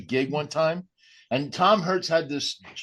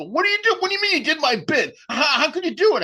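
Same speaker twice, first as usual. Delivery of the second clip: high-pitched indignant outburst; mock-furious squawk; pissed off; 4 dB louder than usual. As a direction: retell it as an outraged, incredulous comic, voice shooting way up.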